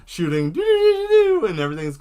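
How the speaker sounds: high, loud doo doo gunfire; mock gunfire. Pilot imitating the ship's guns while flying, loud mock gunfire. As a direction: blast out the doos like lasers.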